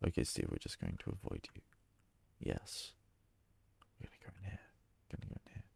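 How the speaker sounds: hushed whisper while sneaking away. Real, not acted. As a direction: whisper it so Steve won't hear. Sneaking past Steve.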